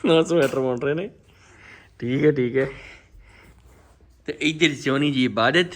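ordinary delivery

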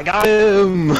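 long, loud victory yell; triumphant shout. Real, not acted. Player celebrating a kill at last, triumphant shout. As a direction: stretch it out in a high, triumphant holler.

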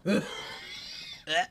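squealing laugh; high laugh. Real, not acted. Reacts to snack taste.

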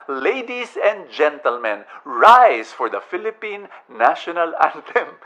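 booming slow stage announcement; loud formal announcer voice; announcer call. Retold or imitated. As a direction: call it out like a theater voice over.

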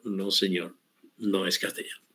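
a quiet, dismissive no from the academy; mock RAE answer. Teacher voicing the RAE rejecting caballú, softly.

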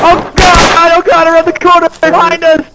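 high, loud terrified shriek; panicked scream. Pretend. Zombies attack, panic.

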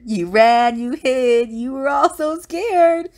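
loud, rising teasing taunt; high, loud singsong gloat; mocking declaration. A narrator's reading. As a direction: jeer at the friends you fooled. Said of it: "Bonehead declared".

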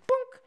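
short, high-pitched boink; quick cartoonish bonk noise; bouncing tablet effect. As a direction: imitate a tiny object bonking off. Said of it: "and the Tum goes".

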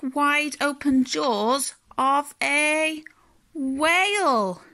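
high, drawn-out storybook climax; dramatic reveal. A narrator's reading. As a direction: stretch it out to reveal the monster.

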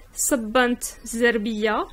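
ordinary talk

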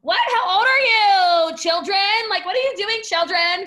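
loud, high, outraged astonishment; flabbergasted retold outburst; hammed-up incredulity. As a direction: interrogate them in mock disbelief. Acts shock at young earners.

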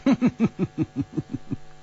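mocking chuckle. Warrior laughs at Hu Tiehua.

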